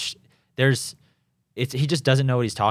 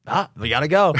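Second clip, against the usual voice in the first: higher, louder mock-urgent cry; excited retold rallying cry; excited urging; 7 semitones higher, 5 dB louder than usual. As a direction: call out the chase, playfully urgent. Retold excited urge to chase.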